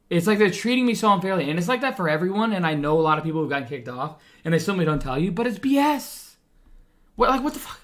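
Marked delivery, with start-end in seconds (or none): 5.3-7.8 fired-up outburst. Real, not acted. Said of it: "that's my rant".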